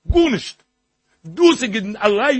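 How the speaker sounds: high, loud outburst; emphatic exclamation. Real, not acted. Preacher insisting nothing else is needed, emphatic exclamation. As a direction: throw it out high and hard.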